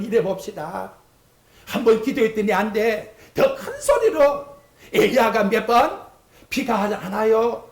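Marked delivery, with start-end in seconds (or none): 3.3-4.5 much higher, slow exhortation; cry louder. Shown as a demonstration. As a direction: push it up high. (높고 느리게 외치듯)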